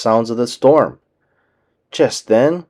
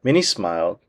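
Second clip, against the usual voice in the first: ordinary delivery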